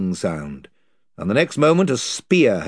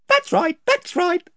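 much higher, bright agreeing voices; the voices cheerfully agree with Caspian; cheerful chorus; 4 dB louder than usual. A narrator's reading. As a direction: agree happily, all together. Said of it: "said all the voices cheerfully".

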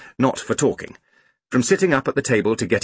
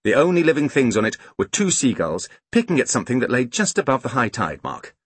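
ordinary delivery, nothing marked

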